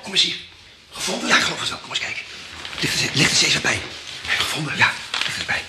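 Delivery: ordinary talk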